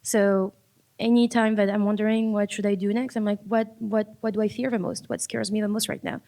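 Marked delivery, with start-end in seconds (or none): none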